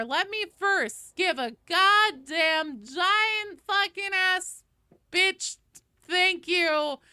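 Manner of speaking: loud, high mock-exasperated rant; playful over-the-top sweary shout-out; hammed-up cursing. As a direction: belt out swear-laden thanks, stretching the words. Nothing but cursing.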